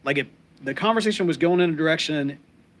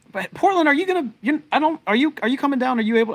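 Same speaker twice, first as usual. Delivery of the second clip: calling out to Portland; 12 semitones higher, 4 dB louder than usual. Real, not acted. Host calling out to his Portland friend to come visit, high and eager. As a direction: lift your voice right up and reach for him.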